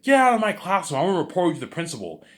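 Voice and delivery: high, loud order, rushing on; angry teacher. Retold or imitated. Teacher orders the hacking kid out of class, angry shout. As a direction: throw him out of the room.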